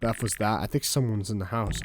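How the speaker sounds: ordinary talk, nothing marked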